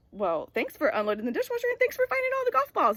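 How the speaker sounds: high, fast, cheery put-on kid voice; mocking the kid. Mother imitating her son's cheery thanks, high mock kid voice. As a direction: play the oblivious teen, chirpy and pleased. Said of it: "this kid was like".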